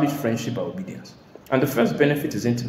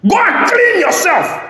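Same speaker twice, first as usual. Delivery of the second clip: much higher, louder, slow shout; furious shout; 9 dB louder, slower than usual. Retold or imitated. Father shouting at his son to go wash. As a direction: bellow it at the son. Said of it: "repeated louder".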